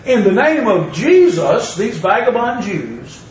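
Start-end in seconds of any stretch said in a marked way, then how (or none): none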